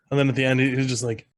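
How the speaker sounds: ordinary talk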